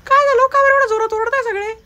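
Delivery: a different voice shouting at everyone; loud shout. Acted. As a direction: bellow over the noise. Newcomer yells at the commotion.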